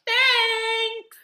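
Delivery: very high, loud, held-out thanks; over-bright thanks. Retold or imitated. Gym worker accepting odd gift, mock-grateful squeal.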